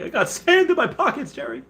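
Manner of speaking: high Kramer voice, fading at the end; wacky Kramer line; Kramer impression. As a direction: do Kramer complaining to Jerry.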